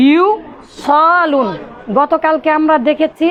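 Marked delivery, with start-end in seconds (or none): 0.1-1.5 ইউসালুন stretched very slowly, syllable by syllable; slow drawn out. Shown as a demonstration. Teacher reveals the original form to students, very slow. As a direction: draw out ইউসালুন for the class. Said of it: "মূলত কি ছিল".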